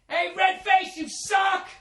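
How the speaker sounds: high, shouted jeer; heckler shout. Retold or imitated. Fan heckling a freckled player, high shouted jeer. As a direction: yell it from the stands.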